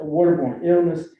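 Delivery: ordinary delivery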